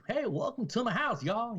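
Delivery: high-pitched mock Southern welcome; Southern accent. Host greeting guests at his cookout, Southern accent. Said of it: "Like a, a Southern accent".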